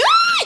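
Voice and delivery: high squealing fangirl scream; sudden high-pitched fangirl shriek; fan scream. Retold or imitated. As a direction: squeal like a starstruck teen. Fan screams seeing drummer.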